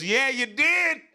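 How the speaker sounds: high, drawn-out gotcha cry; mock accusation. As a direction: crow it like a tattling kid. Brother acted stepping in to accuse.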